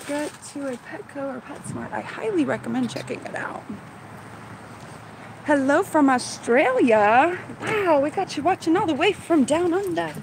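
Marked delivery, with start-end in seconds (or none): 5.4-10.1 a poor, hammed-up Australian accent; slowed, put-on Australian accent that barely lands; bad Australian accent. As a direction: greet them in a clumsy mock Australian accent. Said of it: "that was a horrible, horrible accent".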